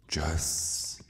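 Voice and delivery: trailing off in a whisper; fading whisper. Acted. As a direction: let the words die into a hush. Parting words to the victim.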